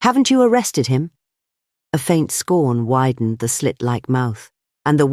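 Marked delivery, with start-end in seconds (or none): 0.0-1.1 sharp, high-pitched question; raised, incredulous demand. A narrator's reading. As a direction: challenge him sharply. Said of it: "asked the other sharply".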